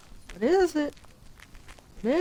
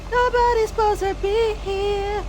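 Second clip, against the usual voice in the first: high, drawn-out put-on voice; imagined cabin dwellers; 10 semitones higher, slower than usual. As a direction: slowly warn off an intruder in a high voice. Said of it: "what those people who live there are thinking".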